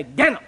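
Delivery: "Daniel" crammed into a single syllable; Daniel in one syllable. Retold or imitated. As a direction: say "Daniel" as one squashed beat, imitating the preacher. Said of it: "one syllable".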